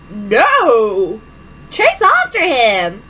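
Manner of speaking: loud resolute cry; rally yourself with a shout; determined shout. Acted. Determined outburst.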